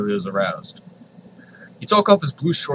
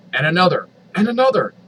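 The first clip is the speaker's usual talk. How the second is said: each "another" higher and louder than the last; building excitement; 7 semitones higher, 6 dB louder than usual. Acted. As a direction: ramp up "and another" into a squeal. Escalating repeated phrase.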